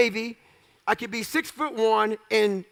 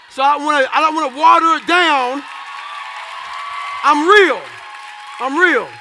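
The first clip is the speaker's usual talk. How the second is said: louder, higher, declaring it to the crowd; emphatic insistence; 4 semitones higher, 7 dB louder than usual. Real, not acted. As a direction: hammer home that he's real. Insisting to crowd he's real.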